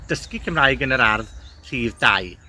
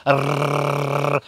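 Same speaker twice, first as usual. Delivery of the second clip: long, sustained trilled "Rrr"; chainsaw rolled R. Shown as a demonstration. Welsh teacher starting the chainsaw, long buzzing rolled "Rrr". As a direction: rev the tongue like a chainsaw on "Rrr". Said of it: "we're starting the chainsaw".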